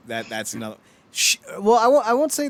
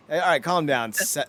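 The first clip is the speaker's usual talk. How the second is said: ordinary talk, nothing marked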